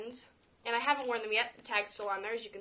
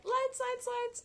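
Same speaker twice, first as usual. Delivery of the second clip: high, delighted repetition; thrilled by the blinking pin; excited; 14 semitones higher, much slower than usual. Real, not acted. Showing the camera the light-up pin, much higher and excited. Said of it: "push this little button".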